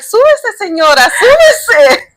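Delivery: high, excited imitation of staff; rousing invitation. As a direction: imitate staff urging her aboard. Staff invite mom into wheelchair.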